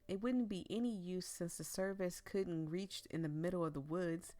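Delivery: usual speaking voice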